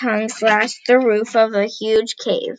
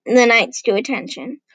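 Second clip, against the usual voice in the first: ordinary delivery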